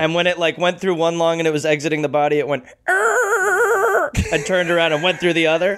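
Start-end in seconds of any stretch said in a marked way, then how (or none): 2.9-4.1 high-pitched mock screech; imitated bullet scream. Joking about a bullet screaming out of a body, cartoon screech. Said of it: "it screamed as it went".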